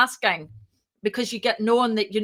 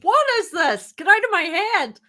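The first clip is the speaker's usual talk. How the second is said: mock exasperated disbelief; 9 semitones higher than usual. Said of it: "It's like".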